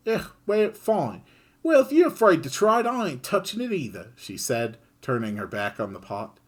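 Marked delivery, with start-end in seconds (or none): 0.1-4.1 high, louder, fast huff; Kid's sulky voice. Acted. Kid refusing the pot, sulky and fast. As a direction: huff and give up on the food.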